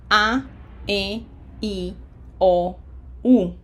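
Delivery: A, E, I, O, U as pure Spanish vowels, each alone; "A, E, I, O, U" spoken separately, like a teacher demonstrating. Shown as a demonstration. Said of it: "let's pronounce the vowels by themselves".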